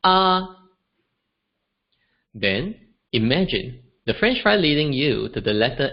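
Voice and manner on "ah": "ah" held high and level; "ah" as Mandarin first tone, high and flat. Shown as a demonstration. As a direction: sing "ah" on one flat high pitch. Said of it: "it sounds something like".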